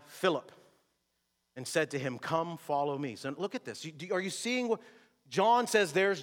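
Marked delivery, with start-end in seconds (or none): none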